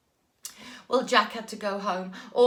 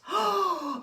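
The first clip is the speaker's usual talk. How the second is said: sharp gasp of delight; 8 semitones higher than usual. A narrator's reading. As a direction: gasp in wonder. Narrator gasps at gift.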